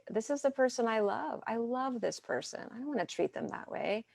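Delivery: calm, tender self-talk. Retold or imitated. Angry partner calming down, reminding themselves of love: soft, tender. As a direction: soothe your own anger with a soft, lifted voice. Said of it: "to really calm down and say".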